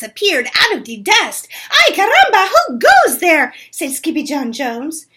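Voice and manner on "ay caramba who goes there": high, loud startled call out; high loud mock alarm; excited call. Skippy hails the Chihuahuas.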